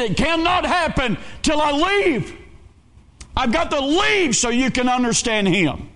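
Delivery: high, driving preacher's cry; impassioned preaching. Acted. Preacher voicing Jesus promising the Holy Ghost, high impassioned preaching. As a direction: preach it high, building to a peak.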